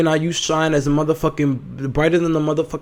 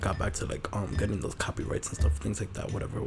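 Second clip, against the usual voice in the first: quiet mumble; 7 semitones lower, 13 dB quieter than usual. Real, not acted. Trails off muttering.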